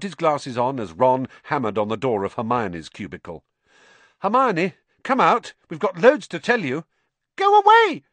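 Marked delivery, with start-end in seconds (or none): 4.2-6.8 higher, louder call to a friend behind a door; calling through a door. Acted. Ron calls to Hermione through the cubicle door, loud and higher. 7.4-8.0 a sudden squeak, much higher and louder. Acted. Hermione squeaks at the boys from inside her cubicle, high and loud. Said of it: "Hermione squeaked".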